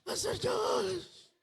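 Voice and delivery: high, drawn-out mock wail; mock-tearful plea stretched long; whiny crying plea. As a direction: ham up a sobbing kid calling the pastor. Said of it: "kabataan umiyak sa akin".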